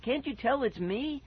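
high, pleading question; crying out. A narrator's reading. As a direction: beg to be recognized. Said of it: "I cried".

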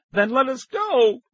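high, loud, drawn-out yell; shout. A narrator's reading. Nicky demanding the man release them, shouting. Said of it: "Nicky shouted again".

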